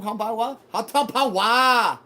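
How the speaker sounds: loud, slow drawn-out call of the name; shouted call. Real, not acted. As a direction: call it out across the room. Calling out a chatter's name.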